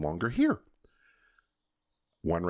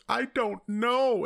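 put-on tearful child; much slower than usual. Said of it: "and goes".

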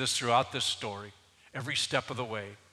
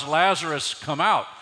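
loud call, still preaching pitch; shout; 5 semitones higher, 10 dB louder than usual. Acted. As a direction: command him out, raised voice. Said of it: "So Jesus shouts".

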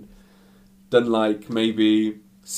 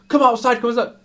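loud, very high goading yell; acted agitated shout; 13 semitones higher, 7 dB louder, faster than usual. Attacker calls him outside to fight, acted shouting. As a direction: imitate a frantic challenger calling someone out.

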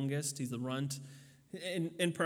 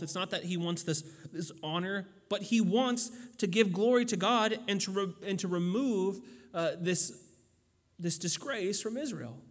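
usual speaking voice

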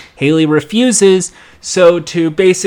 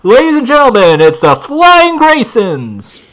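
ringmaster announcement; 7 semitones higher, 5 dB louder than usual. Retold or imitated. Ringmaster introducing the act to the crowd, booming announcer. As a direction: boom it out to the big top, stretching "Flying Graysons".

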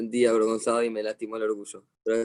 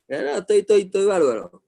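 quick, higher, louder protest, covering embarrassment; proud denial after a fall; insisting he's fine; 6 semitones higher, 5 dB louder than usual. Retold or imitated. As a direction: brush it off, a little too loud. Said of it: "un señor me dijo".

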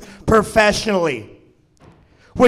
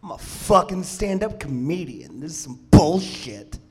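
low, softened grumble to oneself; dejected muttering; 5 semitones lower, 8 dB quieter than usual. Acted. Comic alone in his car, muttering dejectedly. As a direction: mutter it under your breath, low and bitter. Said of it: "sitting in my car just like".

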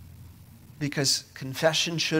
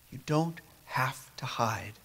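soft whisper, slowing down; whispered reassurance; 8 dB quieter than usual. Real, not acted. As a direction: hush it gently to them. Reassuring the congregation softly.

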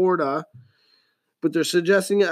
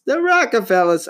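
high, louder mock-posh delivery; put-on high voice; 6 semitones higher, 4 dB louder than usual. Shown as a demonstration. Podcaster dreaming of rich family, high mock voice. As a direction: say it lifted and grand. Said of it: "I've been talking like that all night".

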